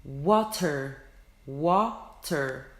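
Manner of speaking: normal talking voice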